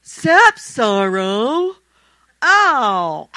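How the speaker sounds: stunned sisters crying out in disbelief; hammed-up astonished howl; shocked gasp. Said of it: "they're going".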